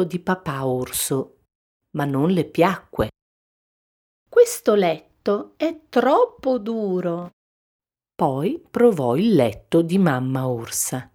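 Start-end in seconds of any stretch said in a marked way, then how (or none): 4.3-7.3 higher Goldilocks voice, a little whiny; mild complaint. A narrator's reading. Goldilocks trying papa bear's bed, complaining. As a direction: grumble lightly in a high voice.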